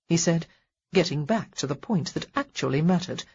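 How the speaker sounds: ordinary delivery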